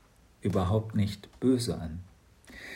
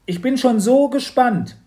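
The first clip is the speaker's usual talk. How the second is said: put-on high, loud girl's voice; a bright, bossy little girl; Mathilda's voice; 13 semitones higher, 10 dB louder than usual. Mathilda excited. (hohe, laute Mädchenstimme)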